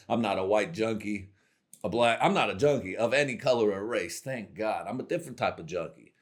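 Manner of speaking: ordinary delivery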